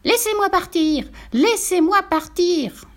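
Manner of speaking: high-pitched, drawn-out piglet wail; howling piglet. A narrator's reading. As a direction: wail to be let go. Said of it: "Le porcelet gigotait et hurlait". (porcelet qui hurle, voix aiguë)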